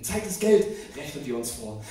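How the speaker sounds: ordinary delivery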